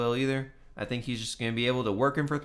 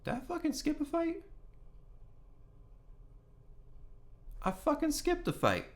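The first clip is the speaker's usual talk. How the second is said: shocked and much higher, half to himself; sudden surprise; 9 dB quieter than usual. Real, not acted. Vlogger realizes he skipped a fight, startled and much higher. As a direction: catch your own mistake with a high, startled yelp.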